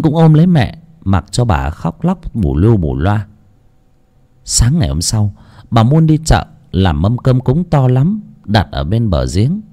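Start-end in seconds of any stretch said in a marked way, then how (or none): none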